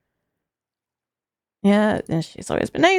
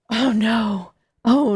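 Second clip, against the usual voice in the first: ordinary delivery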